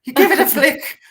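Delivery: high, loud Irish lilt with laughter; hammed-up Irish teacher explaining to kids; silly high Irish accent, laughing; mock Irish teacher.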